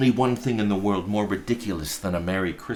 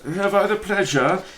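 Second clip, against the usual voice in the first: gentleman's voice; 4 semitones higher, 5 dB louder than usual. Acted. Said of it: "said one of the gentlemen".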